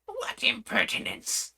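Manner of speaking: high, slow indignant pudding voice; the pudding takes offence; offended pudding. Acted. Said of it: "said the pudding".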